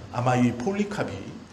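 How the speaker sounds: ordinary talk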